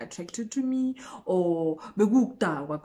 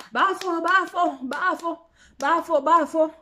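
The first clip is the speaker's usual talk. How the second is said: higher, repeated call of bafo; 9 semitones higher, 4 dB louder than usual. Retold or imitated. Guy calling his friend in the room, raised call. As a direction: call through the door to a friend.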